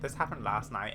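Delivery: plain reading voice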